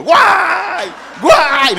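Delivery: high, loud mock wail of grief; wailing shout. Preacher mimics a wail at a funeral, loud and high. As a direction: let out a big, high mourning wail. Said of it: "vamos a gritar los dos juntos".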